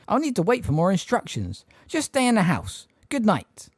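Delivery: a hint of a flustered old neighbour; Miss Fig. A narrator's reading.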